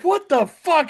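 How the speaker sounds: high, loud disbelieving what; shocked outburst. Retold or imitated. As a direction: blurt it out in disgust. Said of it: "he's like".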